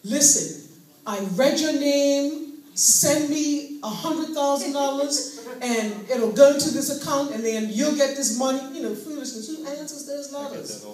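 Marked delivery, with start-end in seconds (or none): 0.0-8.6 loud, slow mock scammer pitch; scam letter voice. Scammer's letter voiced aloud, loud and slow. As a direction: ham up the Nigerian scammer's pitch. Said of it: "they come and they say".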